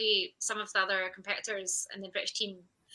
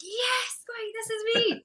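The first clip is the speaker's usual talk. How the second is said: much higher, ecstatic cry of yes; reliving the win; much slower than usual. Retold or imitated. Athlete reliving seeing her name on the scoreboard, ecstatic and high. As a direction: squeal it like you just saw your name win. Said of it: "I was like".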